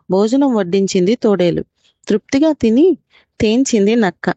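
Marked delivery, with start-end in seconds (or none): none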